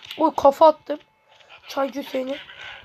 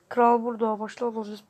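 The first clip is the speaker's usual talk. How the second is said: usual speaking voice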